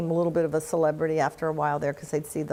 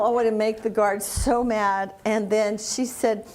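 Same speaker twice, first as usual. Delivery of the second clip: normal talking voice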